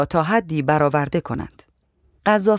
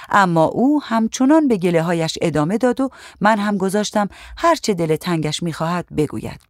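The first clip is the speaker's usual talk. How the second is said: ordinary delivery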